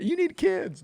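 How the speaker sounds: high, hammed-up nagging voice; mocking nag. Mocking the woman who pushes him to have kids, high mock nag. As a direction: squeak it out like a pushy busybody. Mocking pushy acquaintance's advice.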